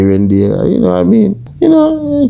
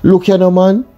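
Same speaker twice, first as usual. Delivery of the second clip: higher-pitched, heated patois scolding; exasperated father scolding his son, voice pitched up; scolding Jamaican father; 7 semitones higher than usual. Acted. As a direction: tear into the unruly boy like a fed-up dad. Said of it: "And his father said to him".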